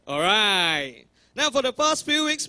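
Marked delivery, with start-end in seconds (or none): 1.4-2.5 high restart. Real, not acted.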